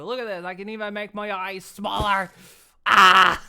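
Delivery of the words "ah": loud pretend shriek; loud playful scream; mock shriek. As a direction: let out a big hammed-up yelp. Startled, showing expressions.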